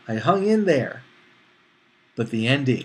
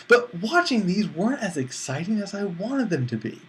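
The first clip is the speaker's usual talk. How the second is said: ordinary talk, nothing marked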